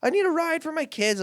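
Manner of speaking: very high pleading whine; imitating a tearful woman pleading; mock weeping. Drug lady pleading with driver about kids, tearful. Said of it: "like, weeping".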